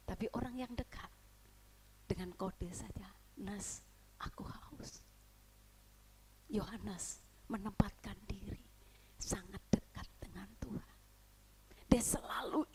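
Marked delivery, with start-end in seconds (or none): 2.1-11.0 softer, slow hush; slow whisper. Acted. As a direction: whisper it slowly and intimately. Whispering the quiet signal. (bisikan pelan)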